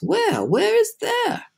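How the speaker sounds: high, drawn-out puzzled cry; bewildered demand. A narrator's reading. Startled creatures press grasshopper.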